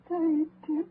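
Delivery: high, breaking with sobs; crying mother. Acted. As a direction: weep for the lost son. Mother grieves dead son.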